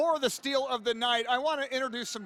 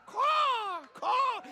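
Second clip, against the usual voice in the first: crow caw; 10 semitones higher than usual. Pretend. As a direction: squawk like a wild bird. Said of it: "I'm gonna keep doing my wild bird impression".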